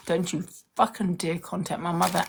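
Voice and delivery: ordinary talk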